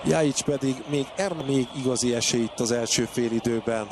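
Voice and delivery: normal talking voice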